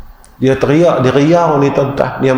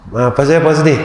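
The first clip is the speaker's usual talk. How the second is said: normal talking voice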